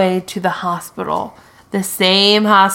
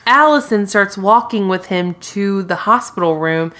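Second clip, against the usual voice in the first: ordinary talk, nothing marked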